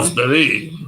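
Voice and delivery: put-on jokey French accent; mock French gooseberry. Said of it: "You sound funny".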